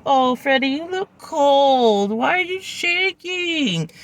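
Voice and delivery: high, slow fussing over the dog; crazy dog mom. Retold or imitated. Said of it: "crazy dog mom".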